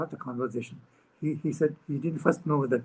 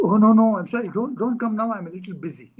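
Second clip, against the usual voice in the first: flustered refusal; 7 semitones higher, 7 dB louder than usual. Retold or imitated. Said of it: "And he said".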